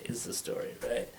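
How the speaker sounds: normal talking voice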